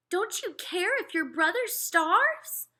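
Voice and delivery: high, slow, scandalized mother voice; a mother appalled at her son; mother scolding her older son, shocked. Acted.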